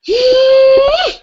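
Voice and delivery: high, loud mock gasp; playful gasp. Tapping leader gasps before cheering the group. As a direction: gasp in exaggerated delight.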